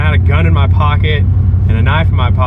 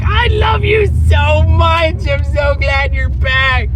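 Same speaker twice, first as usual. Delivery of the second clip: put-on high, slow doting baby talk; baby talk to dog; 12 semitones higher, slower than usual. Letting the dog lick his face, slow high doting. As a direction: gush over the dog like a pet owner. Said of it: "just like".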